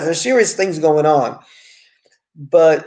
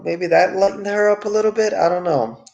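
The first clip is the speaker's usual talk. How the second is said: ordinary talk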